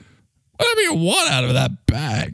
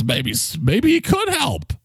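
ordinary talk, nothing marked

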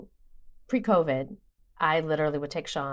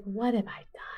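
a breathless whisper to herself; whispered regret; 3 semitones higher, 6 dB quieter than usual. Retold or imitated. Mother ashamed after her son erases his portfolio, whispering. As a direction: whisper it in dismay. Inner regretful thought, retold.

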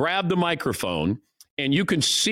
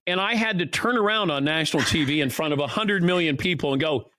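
usual speaking voice

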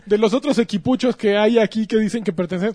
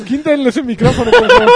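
squealing through laughter; laughing; 6 semitones higher, 6 dB louder than usual. Real, not acted. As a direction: beg while cracking up. Laughing plea.

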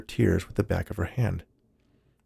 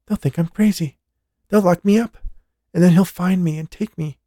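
higher, urgent female voice; lightly voiced young woman in fear; a woman pleading about her lover; frightened woman; 9 semitones higher than usual. A narrator's reading. Jessica voiced as character.

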